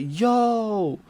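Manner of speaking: loud, high "Yo!"; alarmed cry, drawn out, retold; startled shout. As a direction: yelp in sudden shock. Startled to find chaser right behind.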